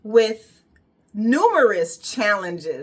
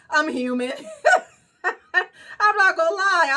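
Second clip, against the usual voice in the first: laughing sheepishly through the confession; giggly, high and amused; 9 semitones higher than usual. Real, not acted. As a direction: laugh it out, embarrassed. Laughing while admitting she crumbled.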